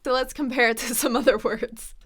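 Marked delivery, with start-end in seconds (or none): none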